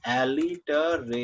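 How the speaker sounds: Alliteration slowly drawn out, each syllable held. Shown as a demonstration. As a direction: stretch alliteration out syllable by syllable for the student. Teacher re-elicits term, syllable by syllable.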